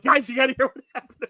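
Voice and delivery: high, loud hail to friends; excited calling out. Retold or imitated. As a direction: act out flagging down pals with big news. Retelling calling out to passing friends.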